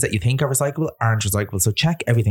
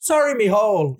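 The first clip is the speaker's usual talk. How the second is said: put-on high, ranting old man; furious old man; 11 semitones higher than usual. Old man scolding a mattress dumper, high furious rant. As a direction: rant like an outraged old culchie at a litterer. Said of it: "He goes".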